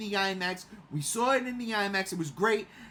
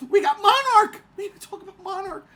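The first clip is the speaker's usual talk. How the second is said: high, loud burst on Monarch; excited; 10 semitones higher, 10 dB louder than usual. Real, not acted. To friends about new Godzilla releases, an excited shout. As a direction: call it out with glee.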